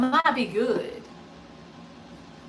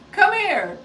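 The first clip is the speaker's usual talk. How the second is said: high, loud call across the house; 10 semitones higher, 7 dB louder than usual. Real, not acted. Host calls Gage in to meet the viewers, high and loud. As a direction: shout for him to come over.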